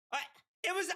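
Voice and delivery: ordinary talk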